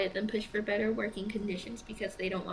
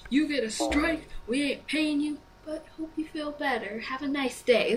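mock corporate voice; 5 semitones higher, 3 dB louder than usual. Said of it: "that's like saying".

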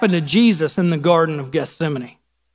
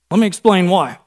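usual speaking voice

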